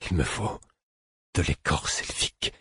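each word articulated carefully, softly; careful articulation. A narrator's reading. Sick narrator asking the fool for elfbark, articulating carefully. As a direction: enunciate with deliberate care, a little hushed. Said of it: "dis-je en articulant soigneusement".

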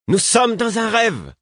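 cri lent, très aigu, autoritaire; hurlement. A narrator's reading. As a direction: hurler en tenant chaque mot. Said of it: "hurla-t-elle". (hurlement impérieux, suraigu)

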